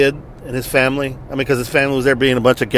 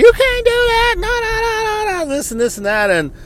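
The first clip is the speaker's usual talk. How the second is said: much higher, screechy imitation of a grandmother; mock shrieking grandma. Grandmother screaming at the drill sergeant, mock shriek. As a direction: mimic a granny screaming, half mocking. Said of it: "was screaming at the drill sergeant".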